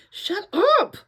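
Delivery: loud, high barked shout. Acted. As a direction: snap it out at friends. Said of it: "Teo barked".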